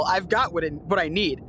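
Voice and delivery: normal talking voice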